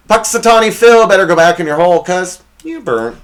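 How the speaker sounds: loud, high mock-taunting callout, slowing at the end; roast callout. Host roasting Puxatawny Phil, loud mock-taunting callout. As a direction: belt out the burn like a roast host.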